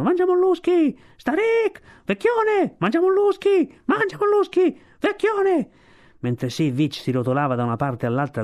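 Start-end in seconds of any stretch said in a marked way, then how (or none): 0.0-5.7 strilli di scherno molto acuti e forti; coro di strilli. Acted. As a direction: uccelli marini che strillano beffardi. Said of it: "gridavano e strillavano". (gli uccelli deridono Sivic con strilli acutissimi)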